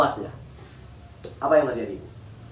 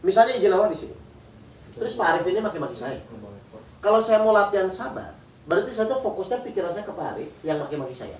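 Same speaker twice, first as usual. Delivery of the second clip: normal talking voice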